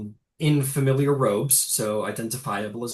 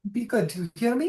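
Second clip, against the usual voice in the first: high, gently firm motherly voice; old mother; 8 semitones higher than usual. Acted. Mother saying goodbye to daughter, high gentle urging. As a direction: tenderly admonish in a high voice. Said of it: "He goes".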